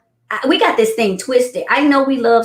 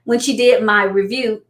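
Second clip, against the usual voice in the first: normal talking voice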